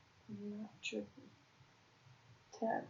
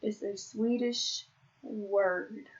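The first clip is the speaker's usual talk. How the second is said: slow emphasis; 4 dB louder, much slower than usual. Real, not acted. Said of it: "that's not how you say".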